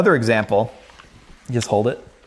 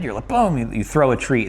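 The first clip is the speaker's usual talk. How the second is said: ordinary delivery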